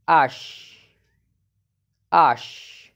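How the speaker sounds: ash with a throaty back-of-mouth ch; demonstrated ash. Shown as a demonstration. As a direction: say ash slowly, the ch rasped in the throat. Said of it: "E C H sound is".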